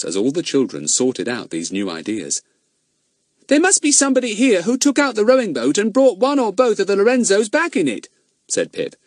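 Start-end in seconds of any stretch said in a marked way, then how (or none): 3.5-9.0 put-on higher, louder eager boy; boy Pip reasoning. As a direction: press the idea on the others. Pip voiced.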